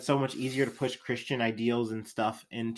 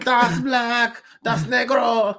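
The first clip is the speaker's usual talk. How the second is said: higher, loud furious German shouting; angry German accent; 11 semitones higher, 6 dB louder, much slower than usual. Retold or imitated. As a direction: bellow it like an enraged dictator. Hitler voiced, fist shaking.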